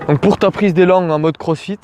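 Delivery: ordinary talk, nothing marked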